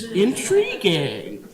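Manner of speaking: drawn-out, swooping mock fascination; mock intrigued. Speaker to audience after a jargon title, mock intrigued. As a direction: stretch it out as if fascinated.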